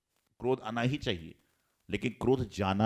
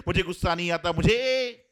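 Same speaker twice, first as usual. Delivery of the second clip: high, rising angry shout; hammed-up rage; angry outburst; 5 semitones higher than usual. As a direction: explode while throwing things. Throwing clothes while denying anger.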